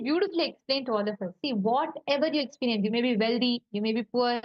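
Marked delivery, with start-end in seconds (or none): none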